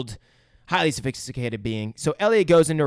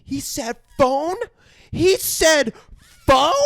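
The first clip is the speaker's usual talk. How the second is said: high, slow question, growing louder; acted disbelief; 12 semitones higher, 6 dB louder, much slower than usual. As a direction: stretch it out, increasingly incredulous. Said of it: "Elliot can't believe it".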